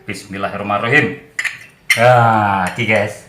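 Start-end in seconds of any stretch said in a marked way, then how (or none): none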